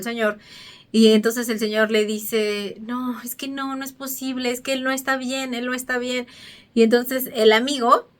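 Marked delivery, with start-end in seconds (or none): 2.8-6.3 much softer, anguished insistence; desperate quiet plea. Retold or imitated. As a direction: hold it down, almost choking on worry. Desperate man refuses to give up.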